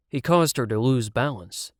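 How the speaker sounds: ordinary delivery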